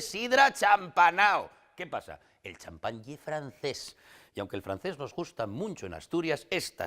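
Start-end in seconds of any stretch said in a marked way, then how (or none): 0.0-1.5 loud, high, drawn-out mum; exaggerated motherly proclamation; mock mother's voice. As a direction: call it out slowly like your mother. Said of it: "como di mi madre".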